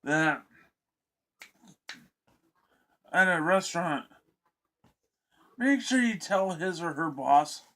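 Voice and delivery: talking through hiccups; hiccuping between words. Real, not acted. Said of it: "Sorry, I got hiccups".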